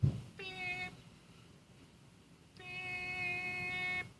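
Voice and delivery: high, soft electronic beeps; slow spaced mock beeps; imitated beeps. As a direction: chirp each beep like a keypad.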